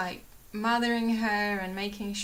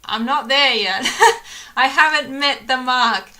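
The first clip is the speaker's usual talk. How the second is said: laughing; 4 semitones higher, 14 dB louder than usual. Real, not acted. Laughs at own self-deprecation.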